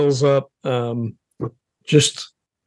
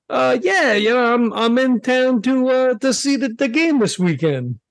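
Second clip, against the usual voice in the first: a bit higher, hesitant cover story; in-character answer; 8 semitones higher than usual. Acted. PI answering the mobster, lightly voiced and hesitant. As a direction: stall a little while making up an excuse. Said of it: "I think he just says".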